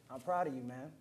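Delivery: normal talking voice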